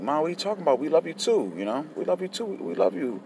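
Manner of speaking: put-on high-pitched kid protesting to mom; retold childish pleading, pitched well up; emotional child. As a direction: act out an upset child reassuring mom. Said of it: "we start getting a little bit emotional".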